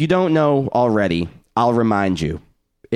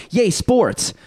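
sarcastic cheer; 11 semitones higher than usual. Acted. Mocking co-host's lack of interest.

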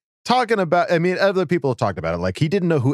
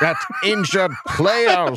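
high-pitched, hammed-up German accent; 5 semitones higher than usual. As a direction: read it grandly as a mock German. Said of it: "requested that I use my German voice".

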